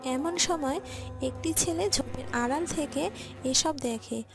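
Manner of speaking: ordinary delivery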